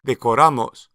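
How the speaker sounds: "decoramos" stressed on -RA-, de-co-RA-mos, said firmly; stress on the ending. Shown as a demonstration. Teacher to learners, shifted stress. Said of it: "shift the stress to the ending".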